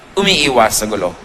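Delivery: ordinary talk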